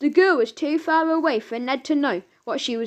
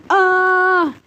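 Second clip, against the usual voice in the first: loud high shriek of fright; sudden loud frightened scream; startled scream; 6 semitones higher, 7 dB louder than usual. A narrator's reading. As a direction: scream as a blob talks to you. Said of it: "screamed Gemina".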